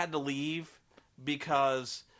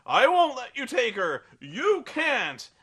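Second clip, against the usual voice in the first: higher, louder mock defiance; louder and higher protective outburst; defiant Jacob; 8 semitones higher, 7 dB louder, slower than usual. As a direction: stand your ground, hammed-up. Jacob confronts Edward.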